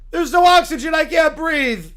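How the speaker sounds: high, loud gasping panic; panicked shout. Acted. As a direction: scream that you can't breathe. Alien suffocating again.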